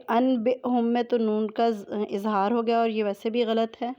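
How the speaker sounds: ordinary talk, nothing marked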